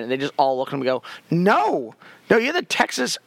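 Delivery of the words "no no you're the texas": mock-outraged, rising higher line by line; hammed-up flat-out refusal, pitched high; incredulous refusal. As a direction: shoot him down in comic disbelief. Acting out parole board's refusal in skit.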